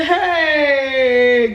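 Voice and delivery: long, drawn-out "hey" of doom; hammed-up cry of dread; dismayed wail. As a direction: wail it like you've been found out. Confronted about boyfriend, retold.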